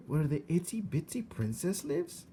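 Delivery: put-on high, rough country girl voice; matter-of-fact young servant girl; Mig's voice. A narrator's reading. Mig voiced in story.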